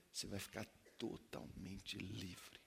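tender reassurance; soft promise. Real, not acted. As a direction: ease down into a gentle promise. Soft slow promise of freedom.

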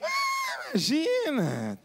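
scoffing high exclamation, hammed up; mock scoff. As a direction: brush off the price as absurd. Jovem rico desdenha do preço. (desdém exagerado e agudo)

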